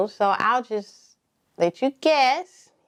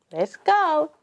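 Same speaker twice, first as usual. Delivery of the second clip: ordinary talk, nothing marked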